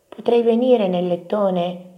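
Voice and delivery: high, slow pleading little mouse; hopeful small voice asking; Topino. A narrator's reading. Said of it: "chiese Topino". (vocina lenta e supplichevole)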